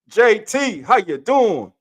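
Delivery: high, loud welcome shout; hype greeting. Real, not acted. Host greets a viewer joining the show, hyped shout. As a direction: call the guest out like a hype host.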